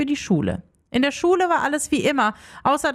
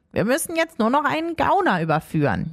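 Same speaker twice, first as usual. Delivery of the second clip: ordinary delivery, nothing marked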